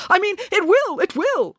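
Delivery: very high, flustered assurance; hasty confirmation. A narrator's reading. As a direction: correct yourself and insist, pitch falling on each assurance. Manager hastily corrects his doubt.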